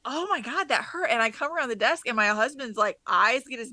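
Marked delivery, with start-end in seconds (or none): 0.0-1.1 pained exclamation. Retold or imitated. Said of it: "I was like".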